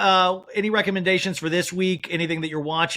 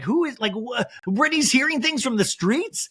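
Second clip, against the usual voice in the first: incredulous; 9 semitones higher than usual. Real, not acted. Incredulous at [name]'s phrase.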